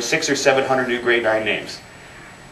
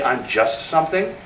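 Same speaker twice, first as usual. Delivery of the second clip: small self-diminishing voice; 3 semitones higher, 4 dB quieter than usual. Retold or imitated. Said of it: "every time you say".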